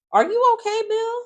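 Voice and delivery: very high, loud incredulous question; exaggerated high-pitched disbelief; mock concern. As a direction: ask it squeaky and pointed. Mocking Bill's failure to step in.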